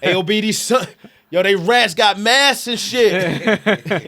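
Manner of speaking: put-on loud, high kid voice with a thick New York accent; heavy New York accent. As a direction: play a twelve-year-old New Yorker in a New York accent, loud and high. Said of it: "with a heavy New York accent".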